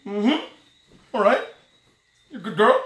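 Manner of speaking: ordinary talk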